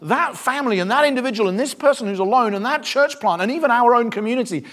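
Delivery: usual speaking voice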